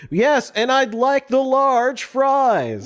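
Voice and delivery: high, slow hammy anchor voice; newscaster voice. Retold or imitated. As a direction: order fries like a TV host. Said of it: "It's like".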